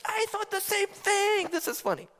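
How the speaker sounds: mock delight at a joke that hits home; delighted agreement. A comedy fan tells a friend, high and amazed. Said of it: "I was like".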